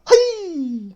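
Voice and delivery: very high, loud whooping cry; imitated Bigfoot shout. Bigfoot mocking him from the trees, loud whoop. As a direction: yelp it out like a laughing Sasquatch. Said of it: "I heard them do a".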